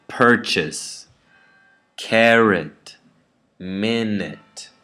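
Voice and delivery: "purchase, carrot, minute" each strong at the front; first-syllable stress. Shown as a demonstration. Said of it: "the first syllable is stressed".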